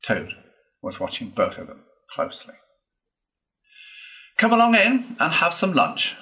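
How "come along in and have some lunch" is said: high, loud, breezy invitation; smooth it over with a cheery offer; diplomatic. A narrator's reading. Toad inviting wavering friends to lunch, diplomatic. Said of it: "he said diplomatically".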